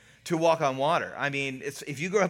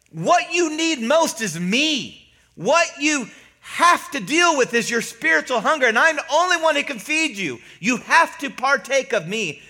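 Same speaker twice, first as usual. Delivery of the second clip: higher, louder, emphatic plea as Jesus; insistent appeal; 9 semitones higher, 5 dB louder than usual. Retold or imitated. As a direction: urge the crowd, stressing each phrase. Preacher voicing Jesus's insistent appeal.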